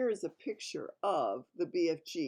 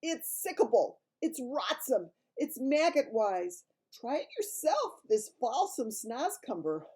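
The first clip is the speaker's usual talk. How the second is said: higher, louder, slow disgusted giant; grossed-out giant teasing; 7 semitones higher, 8 dB louder, slower than usual. Acted. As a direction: relish how awful it tastes. Giant disgusted, mouth full.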